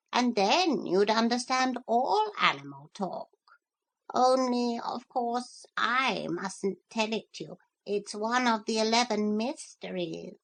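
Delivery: teasing know-all parrot. Acted. Parrot teases about the magic word, high and knowing.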